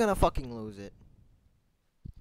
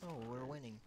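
ordinary delivery

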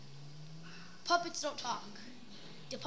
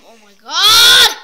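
loud, slow shocked outburst; loud shocked cry; 6 semitones higher, 20 dB louder, slower than usual. Real, not acted. Gamer crashing the bike cries out in shock. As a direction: let out a stunned cry as you crash.

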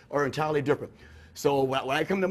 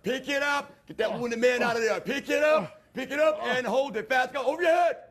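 barking gym coach; 6 semitones higher than usual. Pretend. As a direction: yell commands at a trainee. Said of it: "barking gym coach".

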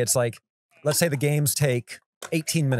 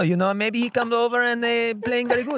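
higher-pitched, hammed-up French accent; 9 semitones higher than usual. Shown as a demonstration. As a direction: put on a cartoonish Frenchman's English. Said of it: "stereotypical French accent".

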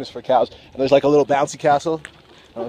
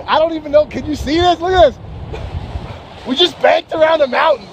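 higher and louder, breathless delight; real thrill; 11 semitones higher, 3 dB louder than usual. Real, not acted. Paraglider showing viewers the mountains, giddy and loud. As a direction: gush like you can't believe the view.

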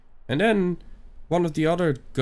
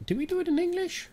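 squeaky falsetto. Pretend. Sudden squeaky falsetto question.

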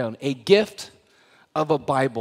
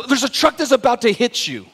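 urgent warning; 6 semitones higher, 5 dB louder than usual. Pretend. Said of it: "and I go".

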